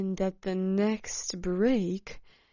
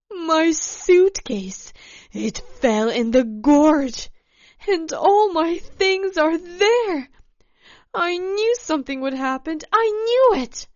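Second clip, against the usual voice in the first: high, loud, frantic wail; loud lament rising in pitch; 7 semitones higher, 8 dB louder than usual. A narrator's reading. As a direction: build to a high, distraught cry. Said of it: "she wailed".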